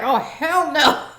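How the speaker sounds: slow, laughing exclamation through a chuckle; laughing doctor. Retold or imitated. Doctor laughing as the baby slips away from his hand, slow laughing exclamation. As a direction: let it spill out slowly through laughter. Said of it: "the doctor was even laughing".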